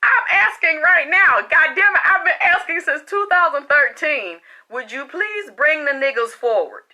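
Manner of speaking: loud, high exasperated outburst; real indignant shouting; angry demand. Real, not acted. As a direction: demand it at full voice, fed up. Angrily demanding proof from accuser.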